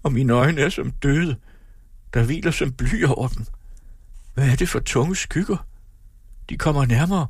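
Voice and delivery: high-pitched, troubled complaint; plaintive lament. A narrator's reading. Sick woman describes shadows over her eyes, plaintive. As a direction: lament as darkness closes in. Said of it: "klagede hun".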